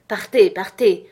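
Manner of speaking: loud, beside herself, ordering her away; a governess nearly out of her mind, commanding the housekeeper to leave; sharp loud order; imperative command. A narrator's reading. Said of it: "j'adressai impérativement, et presque hors de moi-même".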